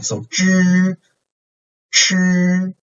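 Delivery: zhi and shi held high and level; first tone. Shown as a demonstration. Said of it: "very high and flight".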